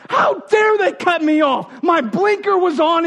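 mock road-rage outburst, high-pitched; freaking out. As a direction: act out an indignant driver shouting at being cut off. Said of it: "like, freaking out".

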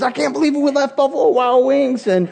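put-on like little kids. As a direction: babble like an overexcited kid. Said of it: "We're on the phone like little kids".

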